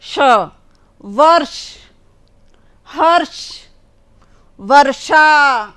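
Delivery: वर्षा said slowly with the retroflex ष curled back; ष in वर्षा drawn out slowly and retroflex; retroflex sh demo. Shown as a demonstration. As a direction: stress the ष in वर्ष, हर्ष, वर्षा, tongue curled. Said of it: "दूसरा सा मूर्धन्य वाला".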